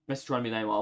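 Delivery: normal talking voice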